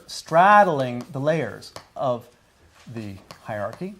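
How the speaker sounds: slow teacher emphasis on straddling; slow deliberate emphasis. Shown as a demonstration. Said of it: "teacher repeats key phrase slowly".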